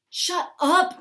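scolding. Acted. Jim scolds his own stomach.

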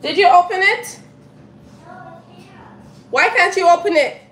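high, loud call from afar. Real, not acted. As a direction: shout the question to the next room. Mother calling to kids offscreen.

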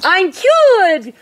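high, drawn-out whoop of joy; joyful whoop. A narrator's reading. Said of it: "whooped Quentin".

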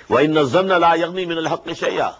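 melodic, measured tajweed chant; Quran recitation. Acted. Teacher recites the verse to his students, chanted tajweed. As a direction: intone the verse as in prayer.

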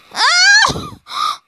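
high sharp yelp; high frustrated yelp; startled shout. Real, not acted. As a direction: yelp out in sudden alarm. Frustrated jump attempt in game.

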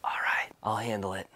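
hushed, breathy whisper aside. Acted. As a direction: whisper it so she can't hear. Quiet scheming aside.

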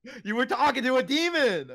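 high, loud, laughing accusation; giddy mock-horrified cry; excited accusation. Real, not acted. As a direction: throw the accusation out in delight. Accusing friend of demon talk.

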